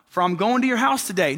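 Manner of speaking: high, bouncy call up to Zacchaeus; children's song call. Retold or imitated. Preacher quoting the song to the congregation, high singsong call. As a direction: call out high, then ease down. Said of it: "But in the song, he says".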